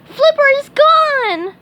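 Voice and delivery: very high, loud anguished wail; crying out. A narrator's reading. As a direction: wail over the lost spatula. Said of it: "cried SpongeBob".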